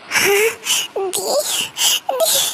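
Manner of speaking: high-pitched, drawn-out sobbing call; crying. Acted. Girl wakes from nightmare and calls her sister, crying. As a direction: wake up sobbing for big sister. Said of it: "तुम क्यों रो रही हो?".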